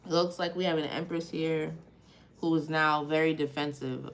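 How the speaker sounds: ordinary talk, nothing marked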